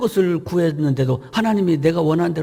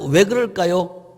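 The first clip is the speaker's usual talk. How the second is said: ordinary delivery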